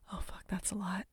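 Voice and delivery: ordinary talk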